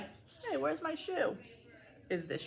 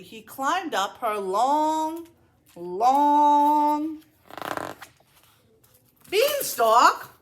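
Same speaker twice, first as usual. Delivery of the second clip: long, long stretched slow, rising higher and louder; drawn-out long; 5 semitones higher, 5 dB louder, much slower than usual. A narrator's reading. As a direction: draw out each long as if climbing.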